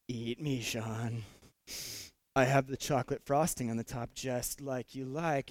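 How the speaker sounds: low, soft seductive whisper of a donut; tempting donut voice. Pretend. Donuts calling out to the pastor, low and seductive. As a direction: coo like a temptation calling his name.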